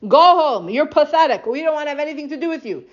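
contemptuous dismissal. Retold or imitated. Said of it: "The Bnei Dan said".

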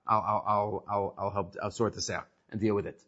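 low, soft evasive mumble; mumbled stalling. Retold or imitated. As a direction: put them off, trailing away. Rabbi stalling.

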